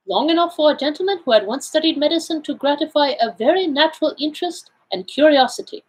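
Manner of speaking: Poirot, self-assured. Acted. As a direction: play the Belgian detective laying out his findings. Figure of Poirot in read story.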